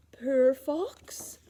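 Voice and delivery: plain reading voice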